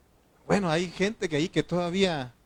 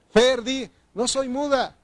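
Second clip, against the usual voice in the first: fervent urging; 10 semitones higher, 7 dB louder, slower than usual. Real, not acted. Calling on a member to confess.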